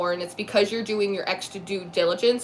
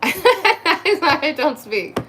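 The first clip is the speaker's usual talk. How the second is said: laughing; 6 semitones higher, 3 dB louder, much slower than usual. Real, not acted. Joking denial while laughing.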